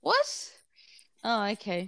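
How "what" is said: much higher, startled question; shocked what. Real, not acted. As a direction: jump up in pitch in disbelief. Shocked at "wisdom" claim.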